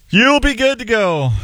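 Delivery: high, sing-song "good to go"; sing-song sign-off. Real, not acted. Sign-off line said twice.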